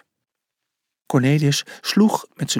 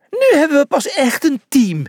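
high, louder cheer; 12 semitones higher, 4 dB louder than usual. A narrator's reading. The team cheers after a great pass, high and bright. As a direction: celebrate the new team. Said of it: "juichten ze".

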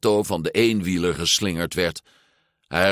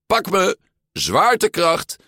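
called out; 11 semitones higher, 3 dB louder than usual. A narrator's reading. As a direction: holler a bold challenge as you launch. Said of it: "riep Alberto". (overmoedig roepend)

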